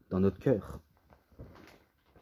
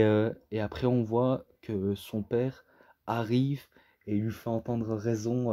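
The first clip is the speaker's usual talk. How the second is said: normal talking voice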